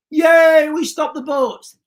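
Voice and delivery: loud, high fake cheer; over-the-top pretend hooray; mock celebration. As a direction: cheer as if it's a triumph, hammed up. Mock celebration of policy success.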